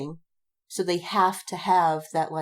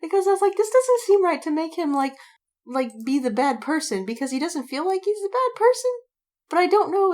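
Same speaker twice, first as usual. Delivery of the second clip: much higher, louder and animated; excited; 10 semitones higher, 4 dB louder than usual. Real, not acted. Writer explaining her plot breakthrough, excited and high. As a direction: rush it out full of excitement.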